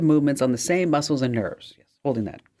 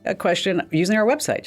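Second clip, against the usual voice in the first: usual speaking voice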